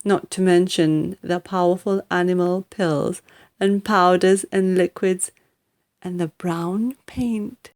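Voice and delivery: plain reading voice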